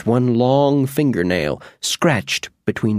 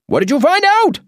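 high, louder wizard voice, demanding; 4 dB louder than usual. Acted. Wizard Oz grabbing the mouse, high demanding voice. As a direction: bark the question eagerly as the wizard. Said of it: "he demanded".

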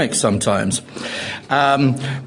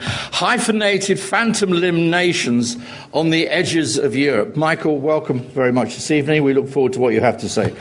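ordinary talk

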